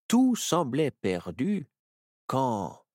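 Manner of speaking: plain reading voice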